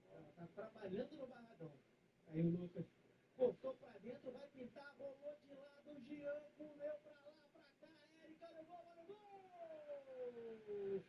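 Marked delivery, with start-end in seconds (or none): 2.3-11.0 rising tension into the shot; live play-by-play. Acted. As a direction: build the attack to the goal. Building up to the goal.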